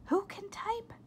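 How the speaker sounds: high startled whisper; surprised whisper. A narrator's reading. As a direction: whisper in disbelief.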